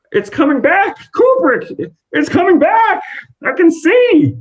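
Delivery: giddy, breathless joy; overjoyed cry. Acted. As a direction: cry out in amazement at seeing again. Modi regains sight, overjoyed.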